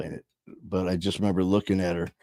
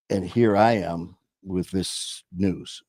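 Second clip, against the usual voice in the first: normal talking voice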